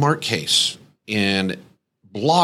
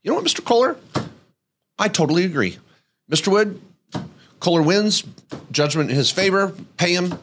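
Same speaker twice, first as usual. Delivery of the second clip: higher, raised voice handing down the verdict; judge's ruling; 7 semitones higher than usual. Retold or imitated. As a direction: play the judge announcing who wins, a bit higher.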